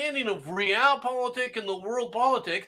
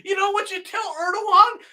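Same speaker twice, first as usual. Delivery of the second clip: ordinary delivery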